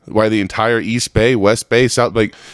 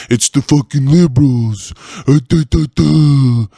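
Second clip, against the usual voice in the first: mock whiny local; 3 semitones higher than usual. Ranting podcaster mocks Coloradans blaming liberals, whiny voice. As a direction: sneer at them in a dumb voice.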